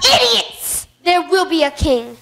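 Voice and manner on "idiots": higher, exasperated snap; angry snap. Acted. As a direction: snap at the fools. Villain snaps at hyenas.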